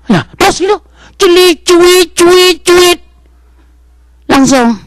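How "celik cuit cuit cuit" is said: high mock siren chirps; siren imitation. Ambulance arrives at the family's house, high siren chirps.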